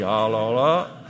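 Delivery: «Alors là» lancé plus fort, étonné et gêné; un «Alors là» exclamé, pris de court; «alors là» appuyé. Retold or imitated. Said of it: "J'ai dit". (face à l'invitation, il s'exclame «Alors là», un peu plus fort)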